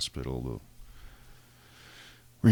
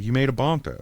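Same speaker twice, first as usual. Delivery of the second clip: mock staff voice; 10 semitones higher, 7 dB louder than usual. Staff voice, higher.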